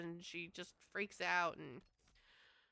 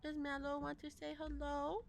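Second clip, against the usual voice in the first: higher, softer sing-song question; cutesy cat talk; 5 semitones higher, 5 dB quieter than usual. Real, not acted. Streamer sweetly asking her cat to greet chat, high and soft. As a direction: ask the cat sweetly, lilting up.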